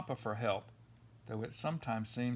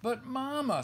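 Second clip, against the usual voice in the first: higher, a cub blurting out a protest; sputtering protest; 10 semitones higher, 3 dB louder than usual. Acted. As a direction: burst out in childish outrage. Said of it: "sputtered the Cubs".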